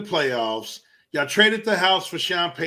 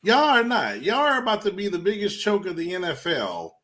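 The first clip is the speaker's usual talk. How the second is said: ordinary delivery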